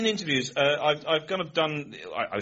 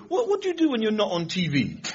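high, puzzled youth; mock youth-speak question; young chap's voice; 9 semitones higher than usual. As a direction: imitate a nosy young fan, pitched up. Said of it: "And he said".

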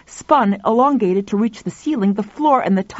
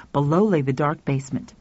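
plain reading voice

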